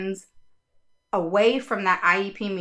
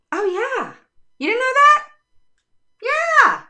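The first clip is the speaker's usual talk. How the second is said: much higher, louder teasing yeah; mock surprise; 14 semitones higher, 6 dB louder than usual. Real, not acted. Advocate teasing parents who never knew, high mock surprise. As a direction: sound amazed they didn't know.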